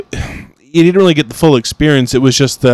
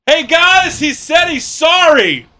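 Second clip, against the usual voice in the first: mocking cashier shout; slower than usual. Cashier mockingly calls his apology to the cooks. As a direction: yell it across the restaurant like the smug cashier. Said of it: "the girl literally goes".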